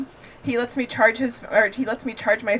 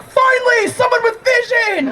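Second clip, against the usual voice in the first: high, loud overjoyed cry; mock delighted shout; 13 semitones higher, 5 dB louder, slower than usual. Comic shouts with joy at a creepy photo. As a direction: burst out like you've found your soulmate.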